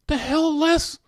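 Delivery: high-pitched, incredulous question; baffled disbelief. Real, not acted. Host puzzled by Les's message, baffled. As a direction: blurt it out high, totally lost.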